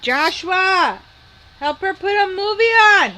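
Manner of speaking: high, loud call across the house. Real, not acted. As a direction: shout for Joshua from another room. Calling son across the house.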